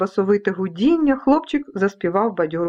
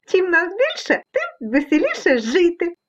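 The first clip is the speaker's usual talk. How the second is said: high-pitched cheery little creatures; high little storybook voices; merry dust specks; 8 semitones higher than usual. Acted. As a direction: chirp back as tiny merry specks. Dust specks answer the cat.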